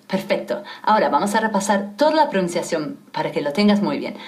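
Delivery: usual speaking voice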